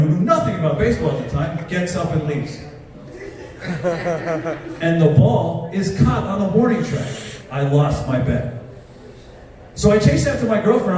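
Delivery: ordinary delivery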